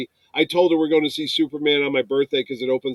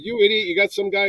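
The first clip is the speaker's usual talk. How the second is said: scolding; 6 semitones higher than usual. Retold or imitated. Wife scolding in retold exchange.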